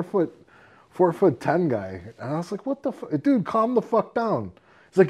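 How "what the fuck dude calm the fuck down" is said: high-pitched, incredulous retold protest; retold exasperation. Bouncer-like friend to a short brawler, exasperated. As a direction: pitch it right up, mock exasperated.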